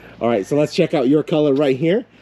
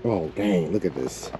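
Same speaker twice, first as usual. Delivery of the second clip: hoarse, raspy throat; 6 semitones lower, 7 dB quieter, slower than usual. Real, not acted. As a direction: speak through a scratchy sick throat. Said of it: "little raspy voice".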